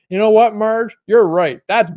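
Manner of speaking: loud, high mock yell into the phone; imitating a yell. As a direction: belt it out like an angry guy on the phone. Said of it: "he's yelling at her on the phone".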